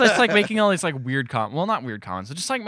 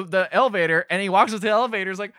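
ordinary delivery

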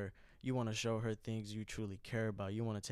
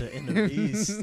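slow, high, loud laughing beast; 9 semitones higher, 10 dB louder, much slower than usual. Acted. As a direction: ham up the inner werewolf. Werewolf joke, growled repeat.

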